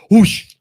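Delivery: loud, high whoosh of rushing water; whoosh sound effect. Shown as a demonstration. Explaining how thoughts flood the mind, a loud whoosh. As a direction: throw out a big whoosh like a flood.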